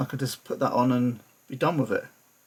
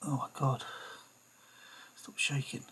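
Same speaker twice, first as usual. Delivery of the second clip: whispered to himself; 11 dB quieter than usual. Real, not acted. Talking to own shaky hand.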